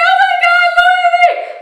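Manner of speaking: high-pitched fangirl squeal; excited squeal. Retold or imitated. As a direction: squeal it out, starstruck.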